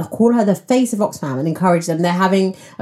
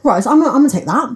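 fast and eager; 7 semitones higher, 3 dB louder, much faster than usual. Retold or imitated. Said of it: "I was like".

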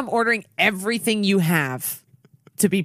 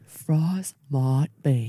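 slow dramatic; 2 semitones lower, much slower than usual. Pretend.

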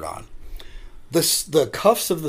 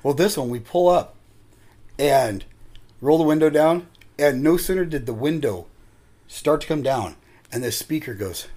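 ordinary delivery

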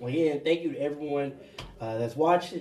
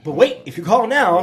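loud, laughing mock TV pitchman; hammed-up infomercial sales pitch; infomercial announcer; 3 semitones higher, 9 dB louder than usual. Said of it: "you sound like an infomercial over there".

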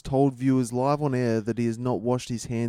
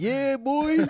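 high excited gloat; 14 semitones higher than usual. Retold or imitated. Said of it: "And you're like".

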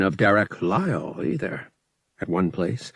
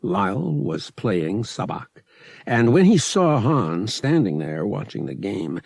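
usual speaking voice

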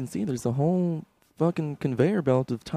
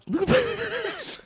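much higher, slow and stretched; squeaky drawn-out; 5 dB quieter, much slower than usual. Acted. Stretched high voice.

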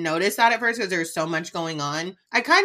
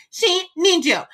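much higher and louder, frantic voice; mimicking Whitney; 10 semitones higher, 4 dB louder than usual. Cohost mocking Whitney's frantic plea, high and loud. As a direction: sell fake concern at full pitch.

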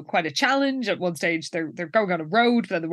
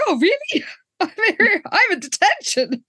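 much higher mock-clueless voice; naive surprise; 11 semitones higher than usual. Enid believes the pep rally joke, naive. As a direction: imitate someone earnestly taking sarcasm literally. Gullible Enid's reply, acted out.

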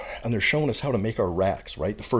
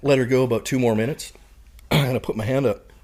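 ordinary talk, nothing marked

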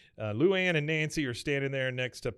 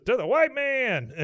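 loud, high, drawn-out mock cheer; rousing toast cheer; 12 semitones higher, 6 dB louder, slower than usual. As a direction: raise a glass and holler it triumphantly. Dale cheers the toast, acted out.

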